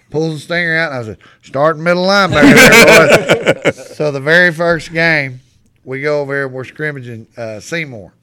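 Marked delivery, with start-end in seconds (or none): 1.4-3.7 shouted coach's order. Retold or imitated. Coach's retold order, played loud.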